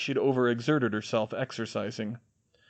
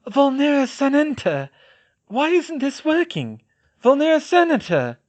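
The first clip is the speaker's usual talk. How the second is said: panicked incantation; 7 dB louder than usual. A narrator's reading. Repeated spell, panicking.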